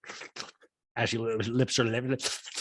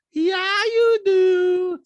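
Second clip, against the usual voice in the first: loud, squealing mock retort; Diana; 9 dB louder, much slower than usual. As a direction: snap back in a very high falsetto. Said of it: "Diana goes".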